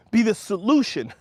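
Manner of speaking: ordinary delivery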